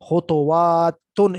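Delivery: خطواتن stretched, ending in ن; slow emphatic reading. Shown as a demonstration. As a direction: sound out خطواتن slowly and firmly. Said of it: "اس کو بہرحال پڑھ لیں".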